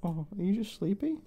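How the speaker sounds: high, soft cooing to a sleepy puppy. Real, not acted. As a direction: coo gently so he can doze.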